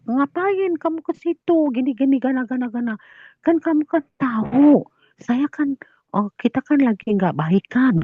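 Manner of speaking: high-pitched imitated complaint; scolding without snapping. In-law complains about their quarrel, scolding. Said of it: "bukannya marah-marah bentak-bentak".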